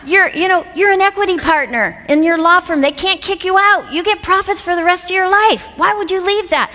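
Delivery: high, insistent self-argument; incredulous inner critic. Retold or imitated. Inner voice talking her out of leaving the firm, incredulous. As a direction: argue urgently, pitched well up.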